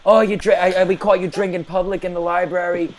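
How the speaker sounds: fake school official. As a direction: pose as a school official on the phone. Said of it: "as Andrew Levitsky".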